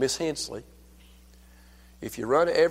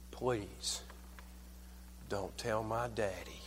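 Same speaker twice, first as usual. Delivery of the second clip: soft pleading; 3 semitones lower, 14 dB quieter, slower than usual. Retold or imitated. Retelling a grandchild's plea.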